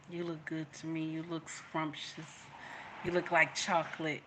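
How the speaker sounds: very soft, low-key compliments; tender compliment. Retold or imitated. Wife retelling compliments to her husband, softly tender. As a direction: murmur it tenderly, imitating sweet talk.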